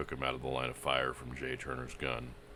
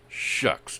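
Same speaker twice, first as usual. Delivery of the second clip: scornful unvoiced scoff; sneering; 7 semitones higher, 9 dB louder than usual. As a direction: sneer it dismissively. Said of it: "Jay Turner sneered".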